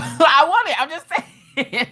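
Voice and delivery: high, giggly talking; laughing. Real, not acted. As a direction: talk through real laughter. Laughing at her own stroke joke.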